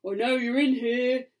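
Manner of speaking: loud, menacing growl; growling villain. A narrator's reading. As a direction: growl a threat at someone hiding. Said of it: "growls a voice".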